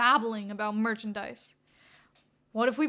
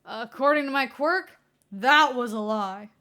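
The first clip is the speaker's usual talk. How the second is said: louder, deliberate; pointed reveal; 9 dB louder than usual. Acted. Naomasa telling Redestro the lie was caught, louder. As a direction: catch the liar out.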